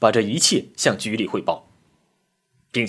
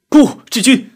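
high, loud cry of refusal; desperate full-voiced yell; anguished shout; 14 semitones higher, 11 dB louder than usual. A narrator's reading. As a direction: cry out to a dying comrade. Comrade cries out to dying friend.

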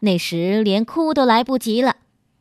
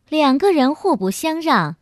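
usual speaking voice

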